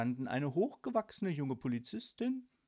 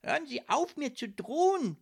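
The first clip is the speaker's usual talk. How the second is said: high, loud, firm protest; vigorous, affronted raised voice; energetic; 13 semitones higher, 8 dB louder than usual. A narrator's reading. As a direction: stand up to the bully. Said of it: "sagte Snök energisch".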